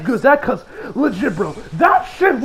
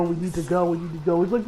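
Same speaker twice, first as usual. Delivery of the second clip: normal talking voice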